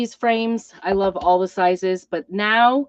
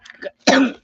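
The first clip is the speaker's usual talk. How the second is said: loud hacking cough; rough chesty cough; coughing fit; 6 semitones higher, 5 dB louder than usual. Real, not acted. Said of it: "to cough, so I apologize".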